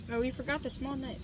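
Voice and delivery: usual speaking voice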